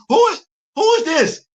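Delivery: high incredulous cry; stunned disbelief, voice jumping up; shocked who is this. Real, not acted. Reacting to the movie, high shocked disbelief.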